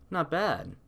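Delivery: ordinary delivery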